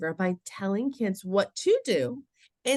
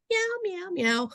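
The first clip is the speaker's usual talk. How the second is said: high little meows; imitating cat voice; 12 semitones higher than usual. Mother imitating her daughter taking cat voice literally, playful meowing. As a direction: mimic a child playing cat. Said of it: "use your cat voice".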